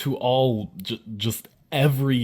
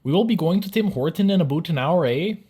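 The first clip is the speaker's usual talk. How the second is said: high, loud mock-Canadian question; singsong caricature Canadian, pitched way up; 8 semitones higher, 6 dB louder than usual. Shown as a demonstration. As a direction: ask it as an exaggerated Canadian. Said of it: "Here's an everyday Canadian sentence".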